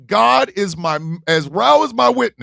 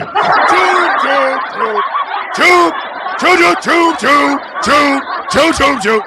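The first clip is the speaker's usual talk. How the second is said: loud, high volley of door-slam noises; imitated slamming doors; 7 semitones higher, 4 dB louder, slower than usual. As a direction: mimic doors banging shut in quick bursts. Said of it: "they started shutting doors and shit".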